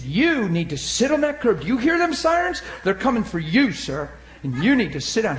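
mock yell of an angry cop; policeman's yell. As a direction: bark orders at a stubborn driver. Policeman yelling at him.

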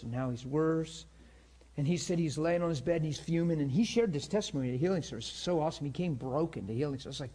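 normal talking voice

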